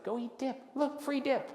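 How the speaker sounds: very high, sing-out coaxing voice; coaxing partner. Retold or imitated. Partner shooing bored Joe to free samples, coaxing. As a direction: coax from up high, as if luring someone away. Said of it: "So he's like".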